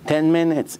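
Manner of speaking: ordinary talk, nothing marked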